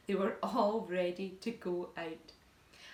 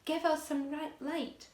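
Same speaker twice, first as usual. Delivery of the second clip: cried out; 7 semitones higher, slower than usual. A narrator's reading. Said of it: "they cried".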